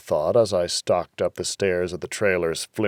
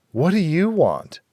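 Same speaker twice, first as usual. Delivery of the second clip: higher, playing lost; feigned confusion; 8 semitones higher than usual. A narrator's reading. Rita feigning confusion at the stranger, higher. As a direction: act innocent and confused.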